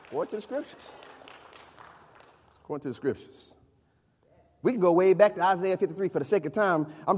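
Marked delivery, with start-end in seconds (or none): 0.1-0.9 very soft echo; very quiet refrain. Real, not acted. Refrain repeated very softly. 2.6-3.5 very soft, low echo; very quiet refrain. Real, not acted. Refrain repeated very softly.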